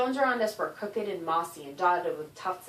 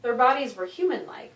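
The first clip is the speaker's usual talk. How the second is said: plain reading voice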